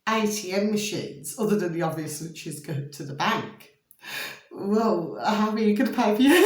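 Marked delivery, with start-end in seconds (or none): 4.5-6.5 fast, giggling question; asking while cracking up; laughing. Real, not acted. Laughs at absurd idea.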